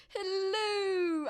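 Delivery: high, drawn-out silly hello; half-hearted cutesy voice; imitating the stomach voice. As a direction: croon it like a talking belly. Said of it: "I can't do it very well".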